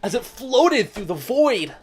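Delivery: dreamy story narration. Acted. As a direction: read it like a wistful narrator. Reading visual novel narration.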